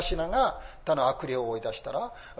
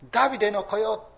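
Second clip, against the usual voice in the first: mock cry; 7 semitones higher, 5 dB louder, faster than usual. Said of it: "と叫んでいますが".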